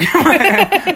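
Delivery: high, loud laughing burst; loud burst of laughter. Real, not acted. Loud laugh during story. (tawa keras melengking)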